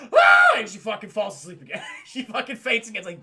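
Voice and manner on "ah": sudden very high, very loud scream. Retold or imitated. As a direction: shriek in terror as she wakes. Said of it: "She's like".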